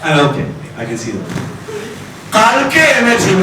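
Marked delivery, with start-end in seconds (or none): 2.4-3.4 oblivious deaf dad, raised and high; top of the voice. Retold or imitated. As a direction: announce it as if nobody could hear. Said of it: "at the top of the voice".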